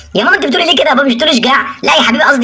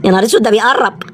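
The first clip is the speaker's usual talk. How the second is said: ordinary delivery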